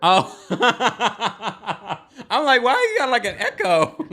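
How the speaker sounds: high, loud, laughing through the words. Retold or imitated. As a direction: giggle through the question. Host quotes herself, laughing.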